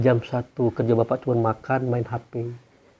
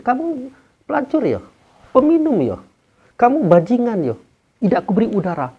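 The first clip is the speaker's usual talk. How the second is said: higher, pointed accusing questions; mock accusing questions; 9 semitones higher, 5 dB louder than usual. Preacher voicing God mocking sinners, higher and accusing. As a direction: fling the charges like a harsh judge.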